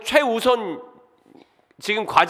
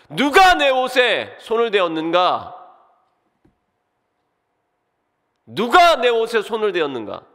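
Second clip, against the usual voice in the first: loud call; 3 semitones higher, 5 dB louder than usual. A narrator's reading. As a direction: cry it out so everyone hears. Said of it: "큰 소리로 외치셨습니다".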